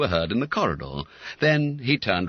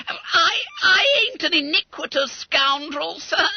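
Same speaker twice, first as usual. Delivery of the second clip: very high, loud crying wail; 5 dB louder than usual. Acted. Boy protesting to his master, high loud crying wail. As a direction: wail in self-pity. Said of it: "wailed Bunter".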